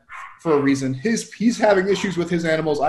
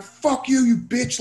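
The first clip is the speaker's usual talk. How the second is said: imitated ranting; 6 semitones higher, slower than usual. Irate stranger insults the driver outside the vet, mock ranting. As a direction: imitate a man going bananas. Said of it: "he's just going bananas".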